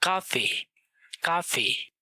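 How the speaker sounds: काफे with a hard k at the start; काफे begins with k, never s; hard k sound. Shown as a demonstration. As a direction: open काफे on a clear k. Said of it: "उसको pronounce किया जाता है का".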